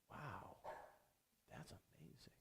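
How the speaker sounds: barely audible, breathed out in wonder; hushed awe. Acted. Daydreamer marvelling at a yelling boss's spit, hushed whisper. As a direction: whisper it slowly, mock-amazed. Dazed onlooker watching the spit fly.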